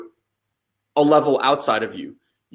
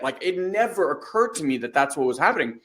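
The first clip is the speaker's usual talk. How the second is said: ordinary talk, nothing marked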